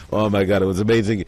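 ordinary delivery

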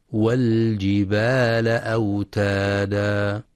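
والجبال with the lam of al said clearly, not merged. Shown as a demonstration. Teacher models the correct reading, clear lam, slow chant. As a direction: sound the lam in والجبال distinctly, chanted slowly. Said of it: "وإنما".

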